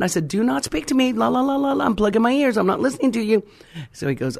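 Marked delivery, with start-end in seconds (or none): 0.3-3.4 higher-pitched la-la-la, ears plugged; playful stubborn blocking-out; childlike refusal. Retold or imitated. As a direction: act out refusing to hear a word.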